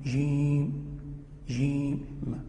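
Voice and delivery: جيم with a clean j and long held ī; correct jīm. Shown as a demonstration. Teacher contrasting the letter's sounds, clean j in جيم. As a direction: draw out جيم slowly, the ج neither g nor sh. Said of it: "بينت".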